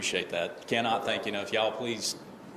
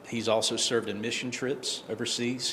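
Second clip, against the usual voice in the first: normal talking voice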